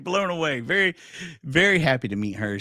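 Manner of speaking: normal talking voice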